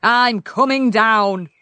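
loud, drawn-out holler; yelling king. A narrator's reading. King calls down from the palace, loud yelling. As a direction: holler it slowly to someone far below. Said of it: "yelled the king".

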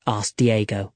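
plain reading voice

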